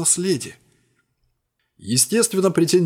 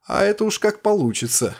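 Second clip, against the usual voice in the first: insolent; 6 semitones higher than usual. A narrator's reading. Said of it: "нагло ответил он мне".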